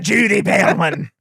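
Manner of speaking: slow, imitated Scottish delivery; put-on Scottish accent; mock Scottish accent. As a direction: mimic the name in exaggerated Scottish. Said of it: "Time Magazine is a Scottish production".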